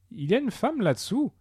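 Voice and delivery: high-pitched, swooping cry of discovery; startled exclamation, voice soaring; astonished exclamation. Acted. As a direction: exclaim it in amazement, pitch leaping up. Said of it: "s'écria Porbus".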